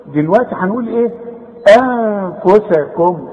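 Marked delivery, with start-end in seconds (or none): 1.8-3.3 slow "أنفسكم" with thin "a" and long humming n; light hamza, nasal. Shown as a demonstration. Teacher models word for pupil, light nasalised reading. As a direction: hold the hidden n in "أنفسكم". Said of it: "رقق".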